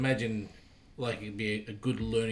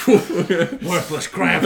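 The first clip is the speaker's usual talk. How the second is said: laughing scorn; 7 semitones higher, 11 dB louder, much slower than usual. Pretend. Laughing mockery of the ship.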